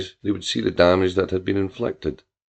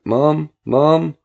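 high, louder call of Mom, Mom; crying out; 6 semitones higher, 4 dB louder than usual. A narrator's reading. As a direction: shout for her through the house. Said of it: "still he cried out".

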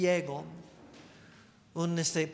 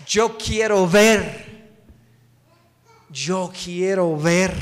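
loud, slow, drawn-out declaration; emphatic plea; 10 dB louder, slower than usual. Acted. Blind man answering what he wants from Jesus, emphatic. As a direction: declare the wish forcefully, word by word.

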